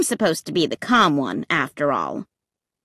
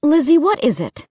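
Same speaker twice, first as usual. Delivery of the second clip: higher-pitched impatient mom; impatient parent's question; 6 semitones higher than usual. A narrator's reading. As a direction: ask it with exasperation, pitch raised. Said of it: "Mom asked impatiently".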